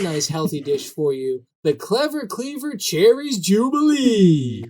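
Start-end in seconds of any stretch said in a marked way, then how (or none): 1.6-4.6 high, slow, hammed-up Providence accent; mock Providence accent. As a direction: imitate a bad Providence accent, stretching the dish's name. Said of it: "Two guys from Providence was the accent".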